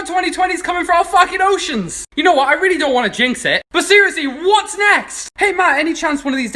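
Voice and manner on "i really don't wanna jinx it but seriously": ordinary delivery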